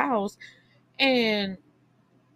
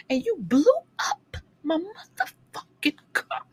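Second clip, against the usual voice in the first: mock outrage; 11 semitones higher than usual. Ranting at the character.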